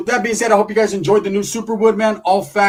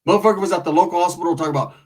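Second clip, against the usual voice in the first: ordinary talk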